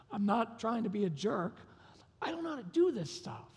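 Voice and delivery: mildly pleading, climbing high; retold helpless plea. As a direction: let the voice rise in helpless appeal. Retold tearful plea to wife.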